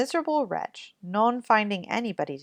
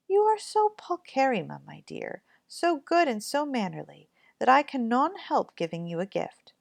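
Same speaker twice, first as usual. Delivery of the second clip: put-on high, admiring old fairy voice; 5 semitones higher than usual. Fairy praising the kind girl, high and admiring. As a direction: lift it up and praise her.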